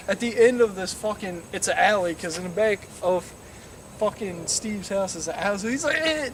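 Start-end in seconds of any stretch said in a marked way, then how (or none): 6.0-6.3 high engine whine; imitated engine revving. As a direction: mimic a car taking off. Imitating the accelerating car.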